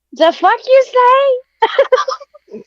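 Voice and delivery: high, loud, crying-laughing disbelief; can't believe the present is a chicken; teen stunned to find a chicken in the crate; disbelieving shock. Retold or imitated.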